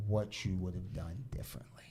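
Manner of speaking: low hushed whisper. Real, not acted. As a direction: whisper it like a secret. Teasing before scary clip.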